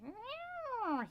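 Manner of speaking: high falling cat whine; drawn-out high meow; protesting cat. Retold or imitated. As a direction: imitate a caught cat's complaint. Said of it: "And he, like".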